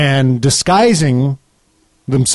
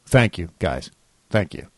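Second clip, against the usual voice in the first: sarcastic; 9 semitones lower, 7 dB quieter than usual. Acted. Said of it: "by thank you, I mean something else".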